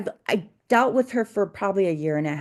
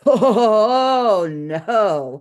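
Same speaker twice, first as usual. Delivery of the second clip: laughing, loud mock horror at the price; mock shocked client; 6 dB louder, much slower than usual. Client balking at seventy-five dollars an hour, laughing mock dismay. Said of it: "is what she told me".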